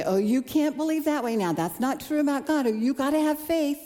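hint of a stern preacher wagging a finger; mock scolding. Imagined preacher scolding her doubts, mildly mock-stern. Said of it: "He didn't scold me".

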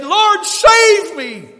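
very high, loud, drawn-out cry; crying out to God. Acted. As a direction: shout a desperate plea to heaven. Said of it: "would cry out to God".

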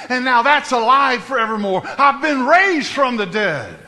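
loud, driving pulpit preaching; full-force preaching. Acted. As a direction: hammer each line home to the church.

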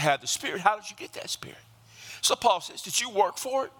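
high, driving preacher's cadence at full force; fiery preaching. Acted. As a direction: preach it hard, rising over the congregation.

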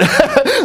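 sudden high laugh; high burst of laughter. Real, not acted. Laughs at own joke.

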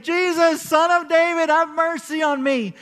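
a blind beggar yelling over people who hush him; retold desperate yell. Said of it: "the more that people are telling him to be quiet".

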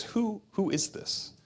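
a hint of a suspicious old father; old Isaac, puzzled. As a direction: ask it like a blind old man sensing something off. Blind father Isaac questions son.

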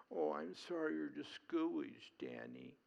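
put-on pitying voice; mock bawling sympathy. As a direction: imitate someone fussing and commiserating, hammed-up. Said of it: "wringing his hands and bawling".

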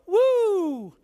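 high, gliding "woo"; light whoop down a small drop; a tame little thrill-ride woo; gentle ride whoop. Retold or imitated. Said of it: "you just kinda go".

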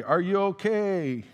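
high, drawn-out mock yell; imitated yell. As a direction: imitate a concerned worker calling out. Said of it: "the girl yells at me".

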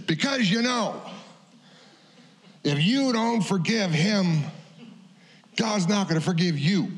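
put-on high, slow, pointed old man's warning; stern old man. Old pastor warning Mike, high slow stern voice. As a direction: wag a bony finger and warn him. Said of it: "points his bony finger in my face".

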